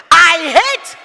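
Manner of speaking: emphatic declaration. Acted. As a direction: proclaim it with force. Repeating God's words loudly.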